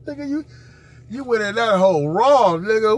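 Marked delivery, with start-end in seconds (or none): none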